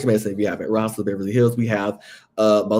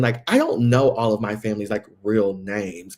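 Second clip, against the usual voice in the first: normal talking voice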